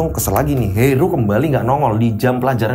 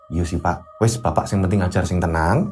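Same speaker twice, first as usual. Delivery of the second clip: calming wife; 10 semitones lower, 6 dB quieter than usual. Acted. Wife calms husband, offers tea.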